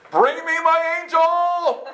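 high, loud, drawn-out command; grand summons. Retold or imitated. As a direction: bellow a hammed-up demand to heaven. Acted grand demand for his angel.

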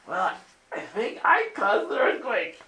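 high, slow sheepish admission; 1 sheepish confession. Retold or imitated. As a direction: confess it like a guilty kid. Retelling own sheepish confession.

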